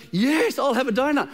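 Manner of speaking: eager yes. Pretend. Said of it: "And I'm going".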